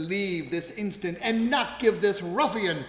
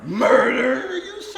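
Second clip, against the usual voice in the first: mock shocked cry; 6 dB louder than usual. Villain feigning shock.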